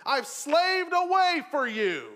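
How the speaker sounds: put-on loud, slow, bitter older brother's complaint; resentful complaint. The older brother complaining to his father, loud and bitter. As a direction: lean hard into years of grievance. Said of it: "or slaved for you".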